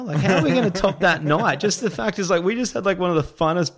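high, loud, laughing exclamation; excited protest. Retold or imitated. Storyteller tells his mate they can't top that night, excited.

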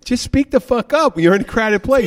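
loud, high-pitched retold scolding; exasperated outburst. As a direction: blast the mumbler for being inaudible. Exasperated retort to whispering customers.

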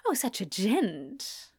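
slow, affected admiration; drawn-out mock-genteel compliment; put-on air. A narrator's reading. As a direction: say it with put-on airs. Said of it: "with a put-on air".

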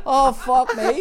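loud, high, laughing "Oh, fuck me"; laughing dismay. Retold or imitated. Apprentice retelling his horror at the sight: laughing loud dismay. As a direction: crack up in disbelief.

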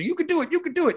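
high-pitched hype without the volume; half-voiced, very high mock yell; mock pep-talk yell. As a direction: imitate a motivator cheering someone on. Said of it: "isn't yelling in your face".

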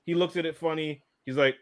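ordinary talk

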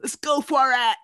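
slacker dude hyped up; stoner voice. Acted. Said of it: "That stoner, stoner voice".

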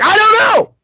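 very high, loud frantic cry; over-the-top panicked shout; exasperated yell. Retold or imitated. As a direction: scream it in helpless frustration.

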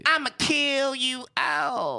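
high slow smooth pimp line. Shown as a demonstration. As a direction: drawl it like a pimp. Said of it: "you're not a pimp".

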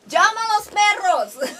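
slow, high-pitched celebrity catchphrase; Ana María Braga. Acted. Imitating a famous Brazilian's catchphrase, high and drawn out. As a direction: call it out high, stretching the words. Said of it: "como dice la brasileña, ya súper famosa".

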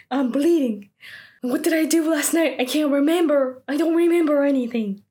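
high, urgent acted panic; panicked. Finding her hands bleeding, acted panic. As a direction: build into a frantic mock panic.

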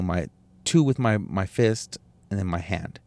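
ordinary talk

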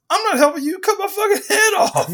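very high-pitched mock indignation; outraged refusal. Sorceress refuses to help the choking hero, high mock outrage. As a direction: squawk it in hammed-up outrage.

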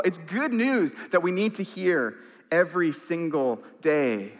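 normal talking voice

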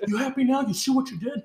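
high-pitched play-acted fury; mock enraged berating. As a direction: lay into the victim, hamming it up. Joins in acted attack on barista.

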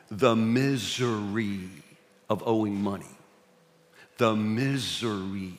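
low, soft and slow; solemn preaching. Acted. Pastor turning serious about debt, slow and soft. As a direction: let the weight of misery sink in.

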